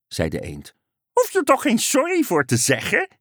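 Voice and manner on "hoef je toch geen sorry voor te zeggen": high, upbeat frog voice; frog calling out. A narrator's reading. Frog reassuring the apologizing duck, high and cheery. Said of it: "riep de kikker".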